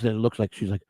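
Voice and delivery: ordinary talk, nothing marked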